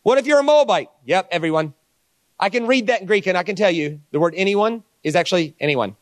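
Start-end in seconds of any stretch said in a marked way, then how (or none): 0.0-0.9 high, louder eager questioner; lightly voiced doubter from the crowd; mock questioner. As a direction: play a keen questioner, higher and louder. Voicing a questioner in the Q&A.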